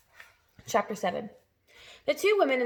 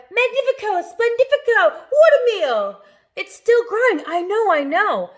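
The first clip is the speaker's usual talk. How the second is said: very high, loud gleeful shouting; excited cry; 11 semitones higher, 10 dB louder than usual. A narrator's reading. Aunts rave over the peach, very high and loud. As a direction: crow with greedy glee. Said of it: "cried out".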